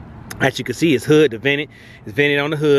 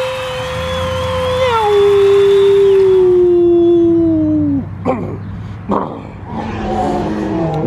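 long, high held werewolf howl; wolf howl; 5 dB louder than usual. Acted. Howling for the Werewolf Gang intro. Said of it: "from that howl".

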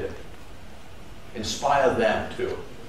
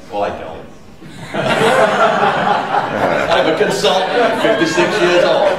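laughing at himself, higher and louder; laughing joke; 6 semitones higher, 6 dB louder than usual. Real, not acted. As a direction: tease the crowd with a laugh. Joking self-deprecation.

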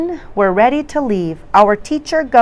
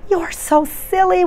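higher excited cry; exclaiming; 10 semitones higher than usual. Acted. Children cry out to their teacher, higher. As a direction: cry out in delight at her. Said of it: "we cry".

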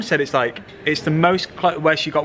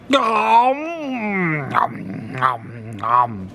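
cartoonish gobbling sounds; mock munching noises; much slower than usual. Vlogger imitating backstage eating, comic munching noises.

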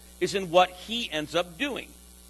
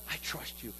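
a hushed, private vow to God; breathy whispered prayer; whispered trust; 8 semitones lower, 15 dB quieter than usual. Acted. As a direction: whisper it, no voice at all. Preacher voicing quiet prayer.

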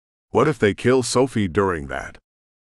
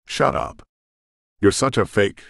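snapping back. Acted. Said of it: "she snapped back".

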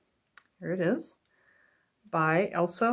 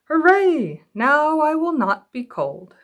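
loud, much higher hooray, then slow; excited cheer; 8 semitones higher, 7 dB louder, slower than usual. Acted. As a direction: cheer like a happy cub. Little Bear cheers.

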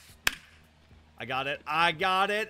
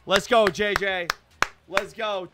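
ordinary talk

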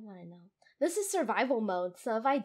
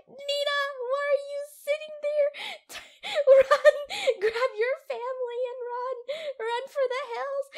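high, loud frantic urging; panicked imagined viewer; 14 semitones higher, 8 dB louder than usual. Retold or imitated. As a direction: shout at her to flee. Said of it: "You're just like".

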